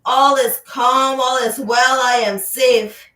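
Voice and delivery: firm affirmation spoken out loud. Shown as a demonstration. Teacher has the class say the affirmation out loud, firm and clear. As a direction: speak it out clearly to the room. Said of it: "Say that out loud".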